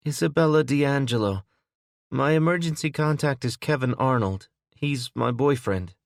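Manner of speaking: put-on a light touch of a shaken young woman's voice; injured young woman. A narrator's reading. Injured woman answers Will.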